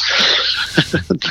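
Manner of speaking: short breathy laugh; self-amused chuckle. Real, not acted. Speaker jokes about his own drunken antics, amused chuckle. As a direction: chuckle at your own joke.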